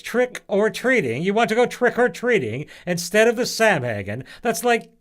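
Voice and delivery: put-on high, loud, appalled old woman; voice up several notches. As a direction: shoot the voice way up in outrage. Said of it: "Pomona's voice went up several notches".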